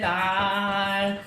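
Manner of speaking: loud, voiced "ah" of letting go; demonstrated release yell. Shown as a demonstration. Yoga teacher showing a class the tantrum, demonstrated release yell. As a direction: let out a mock tantrum "ahh". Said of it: "engage your voice in it".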